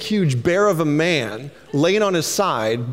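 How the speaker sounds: usual speaking voice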